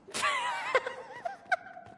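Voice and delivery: high squealing laugh; giddy cackle. Acted. Prankster running off after a prank, giddy laughter. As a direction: burst out laughing as you flee.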